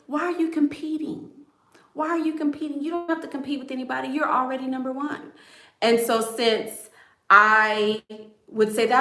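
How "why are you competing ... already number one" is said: voicing her daddy. Daddy to his competing little girl, lightly imitated in a higher voice. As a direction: imitate a dad's repeated question, pitched up. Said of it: "my daddy would always say".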